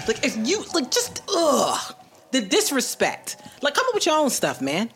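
fed up with copying, get it out; exasperated rant. Real, not acted. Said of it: "I'm so angry".

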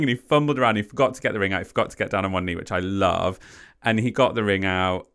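ordinary talk, nothing marked

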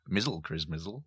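"Mizzle Chris Mizzle" softly, with "izzle" after the last consonant before the vowel; izzle game. Shown as a demonstration. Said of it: "So Merry Christmas".

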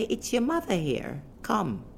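high, commanding mock phone call; playfully imperious call; motherly summons. Acts out her summoning call.

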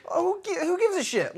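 high incredulous scoff; squeaky who gives a shit; scoffing outburst. Real, not acted. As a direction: shriek it like it's absurd. Scoffing at the plot hole.

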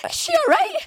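very high, loud alarmed squeal; mock panic. Woman in labour asking after collapsed mum, hammed-up panic. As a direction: squeak out a panicked question, played for laughs.

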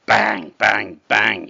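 loud, punched repeated bangs; loud impact words. A narrator's reading. Goat hitting the troll over and over, loud bangs. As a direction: land each word like a blow.

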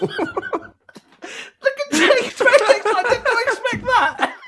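high, loud, slow words through laughter; real laughter. Real, not acted. Guest reacting to host's surprise, laughing hard. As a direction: squeal it out while laughing.

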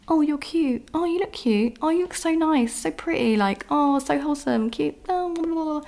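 mock a guy sliding into DMs; mock flirty guy. Mocking a guy sliding into her DMs, sing-song fake sweet. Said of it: "stop sliding into their D Ms and being like".

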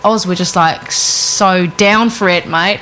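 put-on lightly done Australian accent, drawn out and slow; laid-back Aussie, stretching the vowels; casual Australian drawl, mate-style. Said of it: "That was a great accent".

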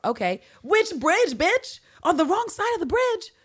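high, loud exasperated disbelief; outraged which-bridge rant; incredulous disbelief. Real, not acted.

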